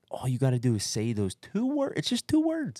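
very high, pleading devil on the shoulder; tempting devil. Retold or imitated. Devil tempting an exhausted runner to quit, high wheedling voice. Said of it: "it was like the angel and the devil".

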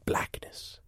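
breathy dramatic whisper; whispered blackness. Acted. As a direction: whisper it as everything fades. Dramatic story climax.

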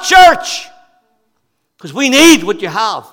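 high, loud impassioned preaching; fervent sermon climax; preaching at full force. Acted. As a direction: drive it home from the pulpit.

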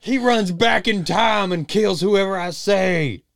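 high, loud and slow, like a movie villain; booming trailer voice. Pretend. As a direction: announce it with huge drama. Mock trailer voice, Flash bit.

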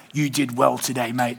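low and soft like a friendly congregant. Retold or imitated. Congregant praising the pastor, low and soft.

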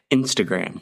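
"Instagram" said in American English, Ins-tuh-gram; American accent. Shown as a demonstration. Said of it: "Eu vou falar com o sotaque americano".

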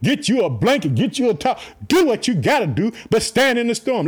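usual speaking voice

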